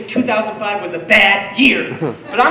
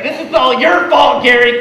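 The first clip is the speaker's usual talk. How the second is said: raised, louder mock scolding; mom's accusation; 6 dB louder than usual. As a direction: imitate a mother blaming her husband. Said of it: "My mom was like".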